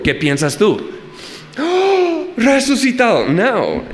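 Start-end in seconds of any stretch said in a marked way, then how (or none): 1.6-3.3 sudden high "¡Oh!" then an eager guess; hammed-up gasp of discovery; mock surprise. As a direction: play the naive listener gasping in surprise. Pretend excited guess at resurrection.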